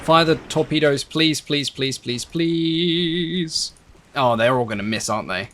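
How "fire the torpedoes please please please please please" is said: repeated pleading, last one stretched high; begging. Real, not acted. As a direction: beg on a run ending in a long wail. Begging the game to fire torpedoes.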